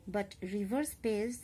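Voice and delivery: ordinary talk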